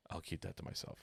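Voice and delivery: hushed, voiceless reply; conspiratorial whisper; retold whisper back.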